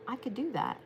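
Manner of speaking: quiet resolve. Retold or imitated. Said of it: "you're gonna go".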